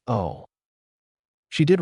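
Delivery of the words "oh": sheepish. A narrator's reading. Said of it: "let out a sheepish".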